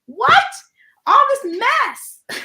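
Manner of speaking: loud, high-pitched exasperated protest; mock outrage. Protesting renamed countries to a friend, mock outrage. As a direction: cry out in exaggerated disbelief, drawing it out.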